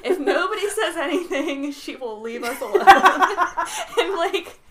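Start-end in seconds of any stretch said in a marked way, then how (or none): 0.0-2.8 loud, high, breaking up with laughter; laughing retelling. Retold or imitated. Telling what she thought as the girl approached, laughing loudly. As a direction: blurt the retold thought through laughs.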